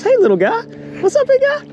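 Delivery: high cooing pet voice. Real, not acted. Angler greeting a wild otter, high cooing baby talk. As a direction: coo at it like a puppy.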